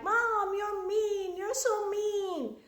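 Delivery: high, slow whiny wail; crying child. Acted. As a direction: draw it out like a sobbing kid. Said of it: "your child is crying and crying and being like".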